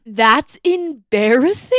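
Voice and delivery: slow, loud, high disbelief; incredulous. Real, not acted. Friend disbelieving that writing a novel is embarrassing. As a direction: stretch it out in disbelief. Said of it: "incredulous at novel shame".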